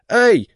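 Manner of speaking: sudden high loud squeal of pain; squeak. Acted. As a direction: yelp like a squeaky toy. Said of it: "пискнул домовой".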